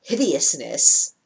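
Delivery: ordinary talk, nothing marked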